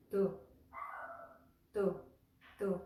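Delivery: short, separate "tu", repeated with pauses; pronunciation drill. Shown as a demonstration. Teacher drilling a syllable to remember, short "tu". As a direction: drill "tu" as a crisp lone syllable. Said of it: "things we want to remember".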